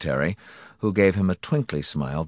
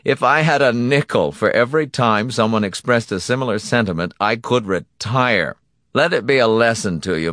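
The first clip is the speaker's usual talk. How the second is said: raised, loud, weary lecture; lecturing villain; 5 semitones higher, 6 dB louder than usual. Acted. Donati schooling his victim after a curse, patronizing. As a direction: lecture the victim with smug patience.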